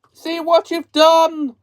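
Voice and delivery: high, loud, slow scream; witch scream. A narrator's reading. Melting witch screams at Dorothy, high and slow. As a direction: shriek it out as she starts to melt. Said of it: "screamed the witch".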